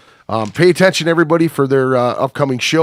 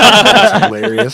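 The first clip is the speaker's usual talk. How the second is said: slow laughing exclamation; 7 semitones higher, 6 dB louder, slower than usual. Real, not acted. Laughing at story.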